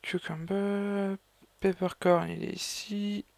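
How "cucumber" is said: cucumber drawn out slowly syllable by syllable; slow careful cucumber. Shown as a demonstration. Gamer rechecking the English crop name, slow and careful. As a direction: spell out cucumber slowly, checking it. Reading crop names, repeats word.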